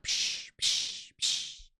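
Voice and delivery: soft unvoiced wave hiss, fading; mock crashing waves. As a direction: breathe out waves hitting the boat. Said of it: "you hear the waves crashing".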